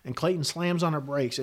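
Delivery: ordinary delivery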